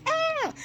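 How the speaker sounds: short high-pitched mock scream. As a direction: let out a high "ah" at normal volume. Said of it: "she screams".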